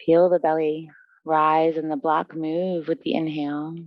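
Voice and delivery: low, even meditation voice with long pauses; guided relaxation. Real, not acted. Yoga teacher guiding students into breath and stillness, calm guided relaxation.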